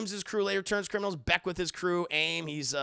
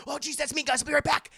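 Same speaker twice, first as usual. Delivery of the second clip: put-on high, hurried kid voice getting louder; flustered excited teen; 9 semitones higher, 6 dB louder, faster than usual. Imitating Peter called into battle, high flustered excitement. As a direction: imitate a boy suddenly called up.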